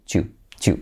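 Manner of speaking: "chu" said as one clipped syllable; "chu" contraction, quick and light; contracted do you. Shown as a demonstration. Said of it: "se puede contraer como chu".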